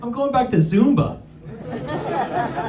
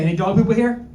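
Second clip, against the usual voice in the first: ordinary talk, nothing marked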